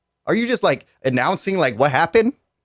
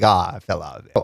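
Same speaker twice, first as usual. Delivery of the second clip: mock J. Cole; 9 semitones lower, 4 dB quieter than usual. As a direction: lower mocking impression of a washed-up rapper. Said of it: "You're just like".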